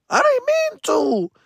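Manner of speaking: very high, drawn-out mock wail; hammed-up squeal of helpless regret; squealed excuse. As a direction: plead in a tiny falsetto whine. Repeats excuse, far higher.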